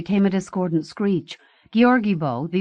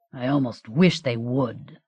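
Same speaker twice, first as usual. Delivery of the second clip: growled; 6 semitones lower than usual. A narrator's reading. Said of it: "Gabandis growled".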